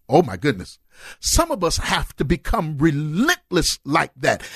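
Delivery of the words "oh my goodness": normal talking voice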